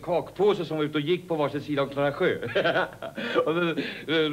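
ordinary talk, nothing marked

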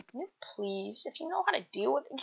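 soft, whispered request; breath-light little plea; hushed plea. Acted. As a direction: ask timidly, almost voiceless. Asking for help, first take.

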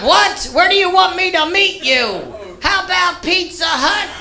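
high, incredulous mock customer; baffled customer. Customer on the phone mocking the lost driver, high and incredulous. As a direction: play the customer as baffled and half laughing.

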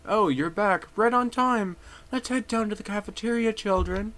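high-pitched teacher voice; chirpy teacher herding the class; teacher character. Acted. Teacher calling children down to the cafeteria, high teacher voice.

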